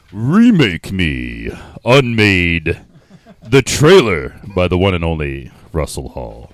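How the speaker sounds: slow, low trailer narrator; deep movie trailer read. Acted. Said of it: "this is where you come in".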